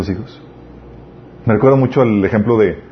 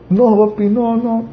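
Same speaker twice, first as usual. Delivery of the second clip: put-on high pleading child's voice, much higher; a little kid begging papa, voice pitched up high; 10 semitones higher, slower than usual. Child begging not to be punished.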